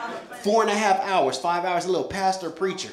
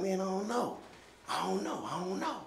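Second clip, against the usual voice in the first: lost murmur; 12 dB quieter than usual. Retold or imitated. Lost, questioning himself.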